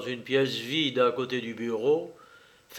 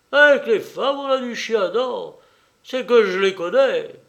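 loud, raised orderly voice; Schweik holding forth; 3 semitones higher, 7 dB louder than usual. Acted. Orderly tells his lieutenant a cautionary tale, loud and raised. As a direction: hold forth loudly to your officer.

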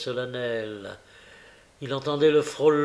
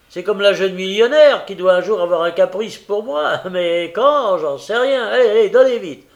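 loud, high character voice; complain, then hurry them up; blustering mail-coach driver; impatient courier; 7 semitones higher, 10 dB louder than usual. A narrator's reading. Courier grumbles and hurries clerk.

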